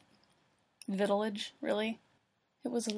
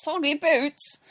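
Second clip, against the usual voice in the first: loud, higher mock Cockney; 5 semitones higher, 8 dB louder, slower than usual. Reader botches the line, hammed-up Cockney. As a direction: shout it in bad Cockney. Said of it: "That was my offensive Cockney accent".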